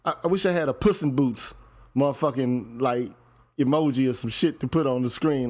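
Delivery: normal talking voice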